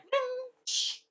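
very high ringing ding into a hush; teleport sound effect. Retold or imitated. Teller shows Alucard's teleporting, high soft effect. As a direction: imitate a magical vanish, high and soft.

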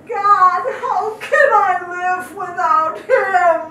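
loud anguished cry to God; a widow calls out to God in her grief; retold crying out. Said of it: "I cried out and I said".

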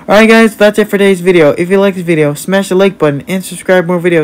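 high, upbeat outro pitch; video sign-off. Real, not acted. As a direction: wrap up brightly for the viewers. Signs off the video.

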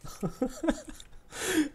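very high disbelieving laugh; high helpless laughter. Real, not acted. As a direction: laugh helplessly at the bad luck. Laughs at own bad luck.

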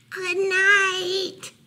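high, drawn-out calling. A narrator's reading. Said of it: "Mouse called a little louder".

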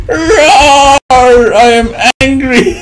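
slow, cheesy mock fury; mock angry villain. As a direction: overact the rage like cheesy acting. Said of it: "That's the worst acting ever!".

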